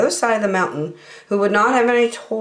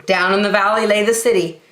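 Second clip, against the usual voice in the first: usual speaking voice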